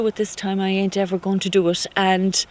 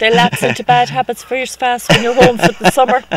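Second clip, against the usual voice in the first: louder, amused and laughing; 3 semitones higher, 6 dB louder than usual. Real, not acted. Student joking with interviewer about family at home, laughing. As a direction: talk through shared laughter.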